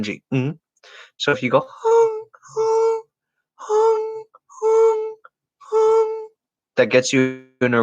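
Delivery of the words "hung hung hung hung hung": very high, sustained falsetto "hung"; high falsetto note, ringing on NG. Shown as a demonstration. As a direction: flip into falsetto and hold "hung" on the ng. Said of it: "say your falsetto with a certain syllable".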